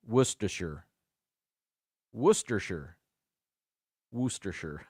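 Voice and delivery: WORCESTERSHIRE as WUSS-ter-shur; correct pronunciation. Shown as a demonstration. Host rehearsing the sauce name, WORCESTERSHIRE said WUSS-ter-shur. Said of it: "Worcester is pronounced".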